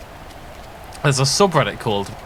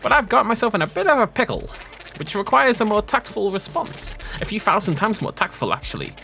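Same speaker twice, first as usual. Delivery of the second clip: high wheeling-dealing character voice; shady villager voice; 7 semitones higher than usual. Acted. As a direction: pitch it like a sly fixer asking a favour. Voicing a game villager.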